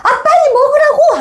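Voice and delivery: very high-pitched acted yell; fed-up kid shrieking an order; angry brother's outburst. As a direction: snap and scream at little brother. Brother's last outburst before hitting.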